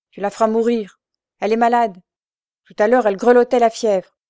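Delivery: alarmed objection at full voice; urgent protest. A narrator's reading. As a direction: plead her case hard. Brother protests for sick girl.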